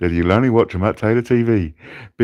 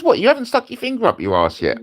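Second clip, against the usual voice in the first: fast, higher mock-incredulous mate; mate's voice; faster than usual. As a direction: play the best mate who can't believe it. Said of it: "he goes".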